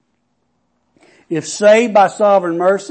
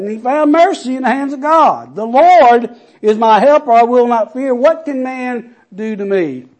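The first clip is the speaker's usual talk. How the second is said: loud, high, rising preacher's cry; preaching at full force; 5 semitones higher, 7 dB louder than usual. Acted. Pastor rousing the congregation with the promise, preaching loud. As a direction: build the scripture up like a sermon climax.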